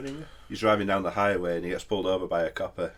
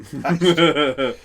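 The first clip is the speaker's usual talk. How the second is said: laughing; 7 semitones higher, 9 dB louder than usual. Real, not acted. Punchline with laugh.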